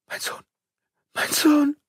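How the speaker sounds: broken, frightened stammer of the words; stammering in shock. A narrator's reading. Mother terrified for her son, stammering in shock. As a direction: gasp it out, terrified for the child. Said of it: "stammelte sie".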